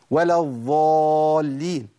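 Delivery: ولا الضالين with ض said like ظ, slow and drawn out; wrong ض for ظ. Shown as a demonstration. Teacher showing students the common mistake, slow and drawn out. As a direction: demonstrate the common mistake of saying الضالين with a ظ sound. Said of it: "مش بنسمعها كتير؟".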